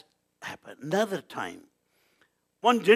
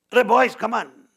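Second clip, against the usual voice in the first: loud, higher-pitched summons; sudden shout; 7 semitones higher, 9 dB louder than usual. Retold or imitated. Bhagavan in Shirdi summons the boys, sudden shout. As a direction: call the boys over urgently. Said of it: "Suddenly he shouted".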